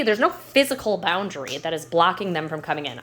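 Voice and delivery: ordinary talk, nothing marked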